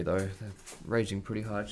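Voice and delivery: ordinary talk, nothing marked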